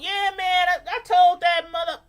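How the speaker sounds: loud, loose street talk. Shown as a demonstration. Showing how she talks after the phone call ends, street and loud. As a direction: drop straight back to street.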